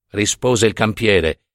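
ordinary delivery

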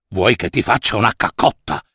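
a hint of a dim donkey. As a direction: ask it a bit deep and unsure. Donkey asks again.

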